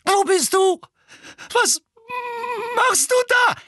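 high, loud panicked calling; frightened cry. A narrator's reading. Dudley, lost in the dark, calls out in fear. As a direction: call out in blind fear.

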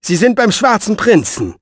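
high, firm statement, falling at the end; exasperated assertion; emphatic answer. Acted. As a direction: set the record straight. Exasperated answer to her fears.

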